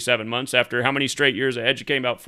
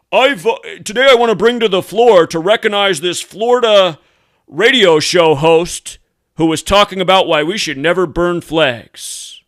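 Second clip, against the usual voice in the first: mock senator; 3 semitones higher, 7 dB louder than usual. Mocking a congressman's floor speech.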